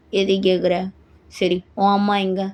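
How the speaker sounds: low-pitched counter-question; curt husband. Acted. Husband questioning wife about her mother, curt. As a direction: throw the question back, low.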